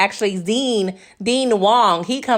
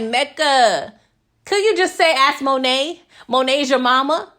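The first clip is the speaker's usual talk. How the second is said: annoyed; 4 semitones higher than usual. Retold or imitated. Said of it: "I was just annoyed".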